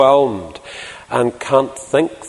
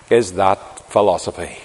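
ordinary talk

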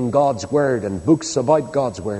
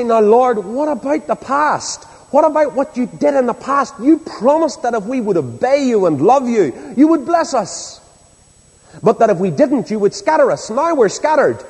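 high, insistent prayer of protest; impassioned plea; 6 semitones higher than usual. Acted. Nehemiah pleads with the Lord over His promises, impassioned plea. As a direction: plead the promises back, phrase by phrase.

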